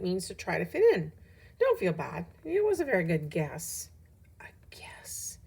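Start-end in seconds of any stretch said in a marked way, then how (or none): 4.4-5.4 hushed whisper to oneself; whispered disbelief. A narrator's reading. Ravi reacts to teacher calling it a guess, whispered disbelief. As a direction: whisper it as a stunned question. Ravi's stung inner disbelief.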